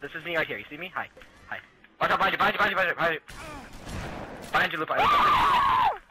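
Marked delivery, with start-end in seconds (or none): 2.0-6.0 rising, urgent warning yell; shouting a warning. Real, not acted. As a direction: yell the warning across the map. Warning teammate of enemy behind.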